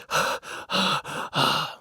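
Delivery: imitated heavy huffing; out-of-breath panting. Man struggling toward the stall, imitated breathless panting. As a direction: pant like someone struggling along. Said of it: "He's, like, out of breath".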